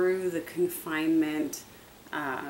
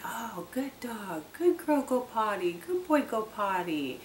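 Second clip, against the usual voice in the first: sweet praise; 5 semitones higher than usual. Retold or imitated. Greeting the dog back inside with praise, higher cooing. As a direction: coo at the dog like a baby. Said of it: "waiting for them with that praise".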